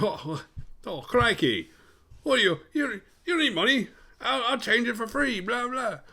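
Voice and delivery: very high-pitched mock Australian bloke; exaggerated Outback guy impression; hammed-up outback Aussie. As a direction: put on a squeaky, over-the-top crikey voice. Voices Mick, Australian outback character.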